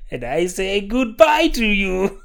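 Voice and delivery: high, drawn-out impression of an Austrian actor; Christoph Waltz. Podcast host signing off as a film star, high impression. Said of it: "a good Christoph Waltz impression".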